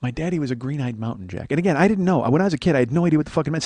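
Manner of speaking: ordinary talk, nothing marked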